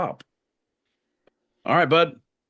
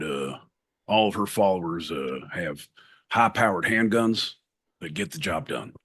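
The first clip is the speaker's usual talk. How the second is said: put-on Southern US accent; 6 semitones lower than usual. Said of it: "Southern U S".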